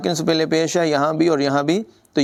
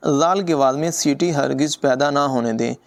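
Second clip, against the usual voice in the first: usual speaking voice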